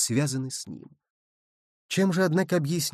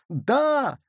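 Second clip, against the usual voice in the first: high, drawn-out cry; yelled agreement; 9 semitones higher than usual. A narrator's reading. Nicholl backs Barbicane's demand, high yell. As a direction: jump in on a high, heated note. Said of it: "заорал Николь".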